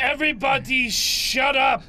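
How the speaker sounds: loud angry yell; shouting. Acted. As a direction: bark it at everyone. Angry old NPC yelling.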